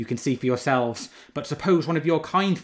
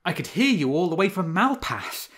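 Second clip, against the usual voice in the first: mocking fox; 5 semitones higher, 6 dB louder than usual. A narrator's reading. Fox mocks mourning wolves.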